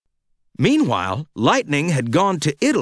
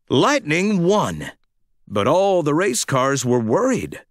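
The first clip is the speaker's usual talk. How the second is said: usual speaking voice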